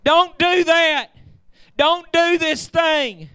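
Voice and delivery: Paul shouting the jailer back from his sword; urgent Paul shouting. Acted. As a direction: cry out to stop the guard, slowing on don't do. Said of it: "and he's saying".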